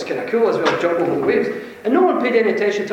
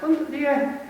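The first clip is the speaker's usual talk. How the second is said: much higher, cocky and laughing Glasgow offer; Glasgow swagger; 9 semitones higher than usual. Retold or imitated. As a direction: play the breezy confident Glasgow healer. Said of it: "as you do in Glasgow in Scotland".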